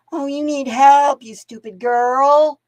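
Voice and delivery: lightly voiced condescending cousin; mocking condescending cousins.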